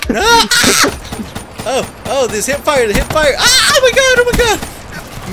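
high, loud exasperated cries; frustrated yell. Real, not acted. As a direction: yell in disbelief at the game. Dying in game, frustrated.

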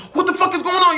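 shocked. Retold or imitated. Startled awake by door kicked in.

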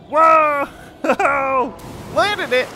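ordinary talk, nothing marked

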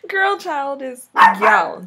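put-on slow "Girl child is gyal" with "gyal" like "gyow"; patois gyal. Shown as a demonstration. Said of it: "G Y A L".

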